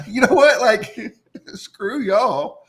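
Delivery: high, loud, laughing through the words; laughing dismissal. Retold or imitated. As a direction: laugh while telling them off. Retold dismissive quote, laughing through.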